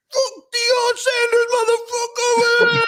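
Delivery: very high, drawn-out squeal; high-pitched mock yell. Host imagining the big match reaction, high mock yell. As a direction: stretch it slowly in a squealing falsetto. Imagined chant after "we're gonna be like.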